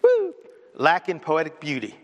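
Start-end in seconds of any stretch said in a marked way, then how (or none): 0.0-0.3 high excited whoop. Real, not acted. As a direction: let out a joyful woo. Preacher's exclamation.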